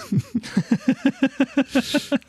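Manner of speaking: high squealing laugh at his own story; burst of laughter. Real, not acted. Podcast host laughing at a Berlin anecdote, high squeal. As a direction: break into a high helpless laugh.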